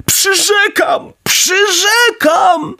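very high, long-drawn dog wail; desperate promise. A narrator's reading. Drowning bulldog promises Pinokio to stop chasing him, high desperate wail. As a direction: stretch each word out in a high plea.

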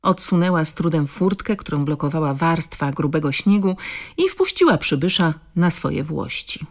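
ordinary delivery, nothing marked

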